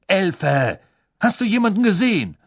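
high, loud, fast impatient question; impatient demand. A narrator's reading. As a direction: bark it at the elf impatiently.

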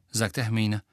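usual speaking voice

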